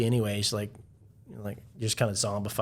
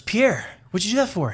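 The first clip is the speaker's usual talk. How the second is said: put-on indignant girlfriend voice, pitched higher; hammed-up outraged protest, higher; higher, louder complaint; 11 semitones higher, 7 dB louder than usual. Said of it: "stands up and goes".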